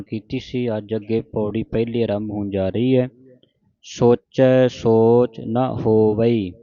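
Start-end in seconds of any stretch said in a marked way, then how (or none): 3.8-6.5 slow recitation, stretching ਹੋਵਈ and stopping; pause after ਹੋਵਈ. Shown as a demonstration. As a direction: draw out "ਸੋਚੈ ਸੋਚ ਨਾ ਹੋਵਈ", then rest. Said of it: "ਹੋਵਈ ਤੇ ਵਿਸਰਾਮ ਹੈ". (ਹੋਵਈ 'ਤੇ ਵਿਸਰਾਮ, ਹੌਲੀ ਪਾਠ)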